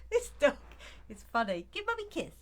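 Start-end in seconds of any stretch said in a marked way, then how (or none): 1.7-2.3 high-pitched pet talk; cooing to dog. Real, not acted. Mummy asking her dog for a kiss, high pet-talk voice.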